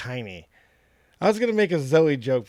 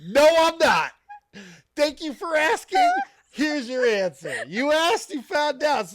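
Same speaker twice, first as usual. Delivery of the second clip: dog's voice; 11 semitones higher, 3 dB louder, slower than usual. Pretend. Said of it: "the dog just decided to answer you".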